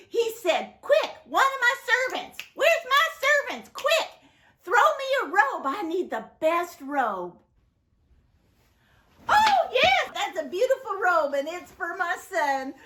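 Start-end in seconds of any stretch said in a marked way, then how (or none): 0.8-7.4 high, eager calling out with a laugh; excited father. Acted. Father calls servants for a robe, excited and high. 9.3-12.7 very high, delighted, voice catching; joyful father. Acted. Father admires the robe for his son, delighted.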